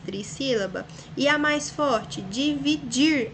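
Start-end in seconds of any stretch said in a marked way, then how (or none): 2.2-3.3 "Dividir" slow in beats, DI - VI - DIR; stress on DIR. Shown as a demonstration. Teacher tests the strongest syllable, stressing DIR. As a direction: split "Dividir" and land firmly on final DIR. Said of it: "A última é a mais forte".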